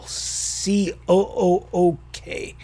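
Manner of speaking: letters drawn out slowly; slow spelling. Shown as a demonstration. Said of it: "kook with the three O's".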